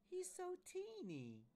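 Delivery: very high, stretched-out squeaky coo; gushing Amy. Acted. Amy fawns over the paper Vader, cooing very high. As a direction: squeal slowly over something tiny. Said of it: "And Amy says".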